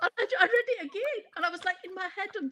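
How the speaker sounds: laughing through the words; amused real laugh over the words. Real, not acted. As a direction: laugh as you tell it. Laughing while retelling.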